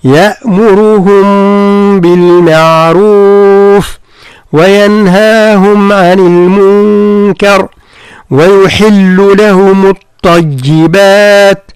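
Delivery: slow, drawn-out tajweed chant; Quran recitation. Shown as a demonstration. Teacher reciting a verse to his student. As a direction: chant the verse slowly, each letter given its due.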